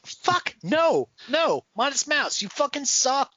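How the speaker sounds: high-pitched, exasperated scolding; mock exasperated outburst. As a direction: chew out the band in mock outrage. Mock heckling a band's guitar habit.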